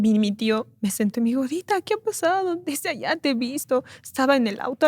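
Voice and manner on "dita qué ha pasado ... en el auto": voz ligeramente actuada, más aguda y suave; tío preocupado, recreado. Retold or imitated. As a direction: preguntar con preocupación cariñosa, en tono alto. (tío preocupado a media voz)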